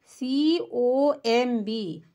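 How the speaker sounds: letters spelt out very slowly; teacher spelling it out letter by letter. Shown as a demonstration. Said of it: "यहाँ पर देखो अब सीओएमबी".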